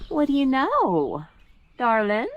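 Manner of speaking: high, slow cooing at a rabbit. Real, not acted.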